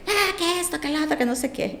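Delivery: high-pitched frantic rant, imitating herself; mock hysteria. Mother mocking her own hysteria while driving, high frantic rant. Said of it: "ya venía la histeria".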